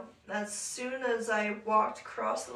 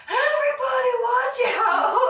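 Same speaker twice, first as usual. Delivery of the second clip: loud, high holler; mimicked boyfriend shouting to the whole room; retold crowd-clearing yell; 14 semitones higher, 12 dB louder than usual. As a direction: yell a warning across the party, hammed up.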